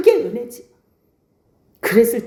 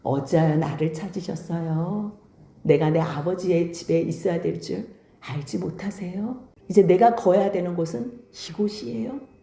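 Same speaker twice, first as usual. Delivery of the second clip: low, soft, courteous answer; polite, respectful reply; 6 semitones lower, 6 dB quieter than usual. Acted. Young Jesus answers his upset mother, quietly polite and composed. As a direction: answer gently and respectfully in a lowered, softer voice. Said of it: "예수님은 정중하게".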